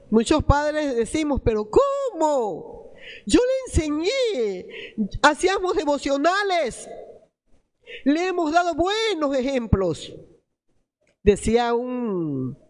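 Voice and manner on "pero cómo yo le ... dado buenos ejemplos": incredulous parent. Acted. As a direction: protest like a wounded, disbelieving parent. Padres decepcionados que protestan por hijos. (padre incrédulo que protesta)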